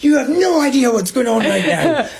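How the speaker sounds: high laughing mock yell; retold shouting. Bartender yells back at the bystanders while laughing. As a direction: snap back through laughter, half-shouting.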